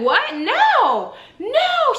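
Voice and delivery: high-pitched mock disbelief; shocked denial. Acts out woman's shocked denial.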